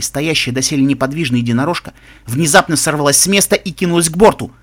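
plain reading voice

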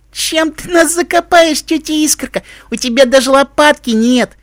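put-on very high, drawn-out child's voice.

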